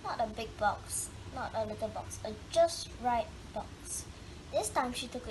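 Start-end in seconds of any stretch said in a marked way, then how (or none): none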